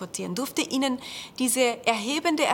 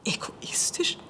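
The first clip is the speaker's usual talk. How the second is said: breathy, puzzled whisper; breathy vintage purr; slower than usual. Acted. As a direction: whisper it back, irritated. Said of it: "schaute irritiert auf".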